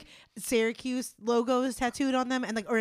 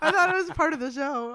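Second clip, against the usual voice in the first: laughing; 8 semitones higher, 7 dB louder than usual. Real, not acted. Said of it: "laughing at own cough".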